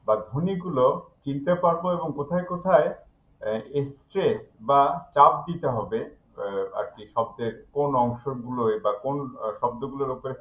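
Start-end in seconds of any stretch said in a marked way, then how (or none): none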